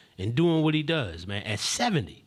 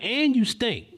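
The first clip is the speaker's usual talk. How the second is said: high, raised jab; imitated insult; 9 semitones higher, 4 dB louder, slower than usual. Man going on at young woman, imitated confrontational insult. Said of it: "he started going on her".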